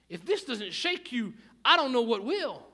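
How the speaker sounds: emphatic sermon climax, rising in volume; forceful preaching. Real, not acted. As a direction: build to a loud, high peak.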